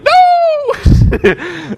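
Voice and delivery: loud high falsetto no; mock panicked wail. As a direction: cry out in hammed-up girly panic. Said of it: "badass demeanor shift from smug to".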